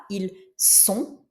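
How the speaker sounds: "ils sont" with a sharp S, no Z; S sound in ils sont. Shown as a demonstration. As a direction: give "sont" a clear S. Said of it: "c'est bien le S qu'on prononce".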